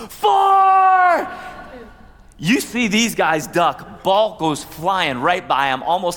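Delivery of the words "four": "Four!" yelled high and loud; high, loud warning cry of "Four!"; warning shout. Retold or imitated. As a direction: bellow "Four!" to warn the group ahead. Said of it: "And I was like".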